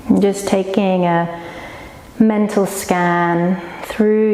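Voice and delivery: slow, unhurried yoga nidra guiding voice; guided meditation. Real, not acted. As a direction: lead them slowly into rest, with long pauses. Leads yoga nidra relaxation.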